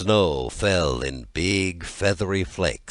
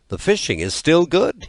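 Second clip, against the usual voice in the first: cheerful boast; 11 semitones higher, 4 dB louder than usual. A narrator's reading. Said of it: "he said to his comrades".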